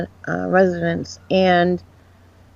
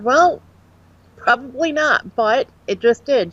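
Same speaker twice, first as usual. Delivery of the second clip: incredulous retort; 5 semitones higher than usual. Retold or imitated. Telling the girlfriend about the growling Ouija board, amazed. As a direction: toss it back, half amazed it really happened. Retold reply after the growl.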